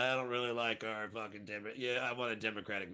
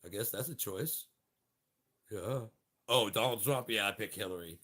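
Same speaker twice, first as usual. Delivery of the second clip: ordinary talk, nothing marked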